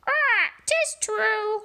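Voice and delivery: very high squawking parrot. Acted. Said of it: "squawked the bird".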